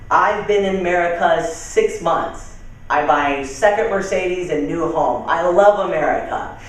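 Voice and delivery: put-on Ahmed's slow accented English, stretched out; broken English in a foreign accent. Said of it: "as I do the accent".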